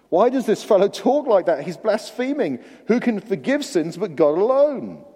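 loud, high, scandalised protest; shocked accusation of blasphemy; indignant religious leaders. Retold or imitated. Religious leaders objecting to Jesus forgiving sins, loud indignation. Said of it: "Did you see that in verse seven".

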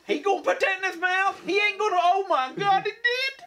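high shocked squeal, building to a cry; lady in audience. Retold or imitated. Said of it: "This lady in the back".

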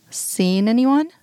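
slow, thoughtful echo of the question; musing. A narrator's reading. Woman echoing his question back to him, slow and musing. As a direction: turn "Seeing anyone?" over slowly, as if pondering it. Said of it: "she repeats musingly".

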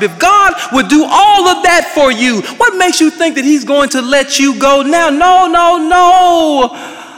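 preacher building up to a triumphant no; rising preaching climax. Real, not acted. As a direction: drive it home to the congregation.

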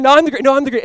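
high, loud one-upping; mock squabbling. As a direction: bicker like disciples fighting over first place. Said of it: "they're saying".

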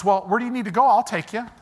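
high, chirpy, cheery greeting; dorky neighbor. Retold or imitated. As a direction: play the oblivious happy neighbor. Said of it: "the guy goes".

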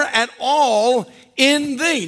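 every word drawn out, weighing thee; slow emphatic scripture. Real, not acted. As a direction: stretch it, land hard on thee. Preacher quoting scripture, drawn out.

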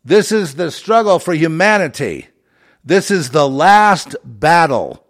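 "This is it" shouted high and loud; loud high rallying cry; booming proclamation. Retold or imitated. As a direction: declare it like the end of the world. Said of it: "R F K Junior says".

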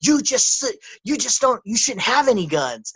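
lightly hammed-up Biden telling the guy off; mock Biden voice. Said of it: "he's saying".

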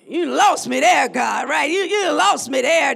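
half-laughing objection to God; mock protest. Voicing the confused listener.

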